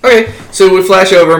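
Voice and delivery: normal talking voice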